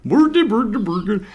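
high-pitched, sing-song mock Swedish gibberish; Swedish Chef. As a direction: put on a silly, high Swedish accent. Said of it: "That's the Swedish chef".

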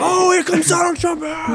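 mock announcer shout. Announcing Trump at a rally.

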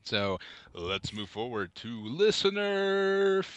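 slow, stretching out the final word; drawn-out segue. Pretend. Host moving on to listener feedback, drawn-out. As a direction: stretch "feedback" into a long held announcement.

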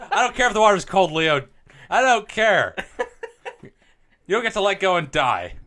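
loud, desperate movie-heroine plea; pleading shout. Pretend. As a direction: yell it over the sinking ship. Acting out Titanic scene, Rose.

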